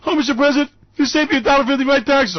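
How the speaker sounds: high, loud, fast gushing fan; gushing supporter. Retold or imitated. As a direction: swoon over the president in mock gratitude.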